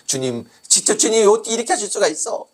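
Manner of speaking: high, aggrieved complaint to God; acted outraged disbelief; indignant protest.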